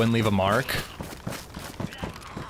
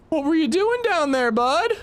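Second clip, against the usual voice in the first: high, mock-innocent teasing; teasing dad; 13 semitones higher than usual. Dad teasing his fallen kid, high mock-sweet voice. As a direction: sweetly pretend to care while mocking. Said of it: "my dad would ask me".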